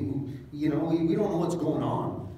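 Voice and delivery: ordinary talk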